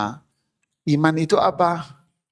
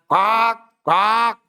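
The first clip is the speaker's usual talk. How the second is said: crow caw; 5 semitones higher than usual. Retold or imitated.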